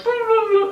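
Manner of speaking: high-pitched imitated robot burbling; playful high droid noises; droid babble. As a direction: burble like a little beeping droid. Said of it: "And then B B eight's like".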